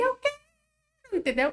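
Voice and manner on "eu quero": put-on very high shrieking child's cry; child's tantrum cry. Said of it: "ela grita". (grito agudo de criança em birra)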